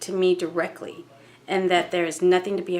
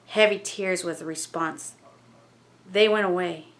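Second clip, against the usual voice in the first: ordinary delivery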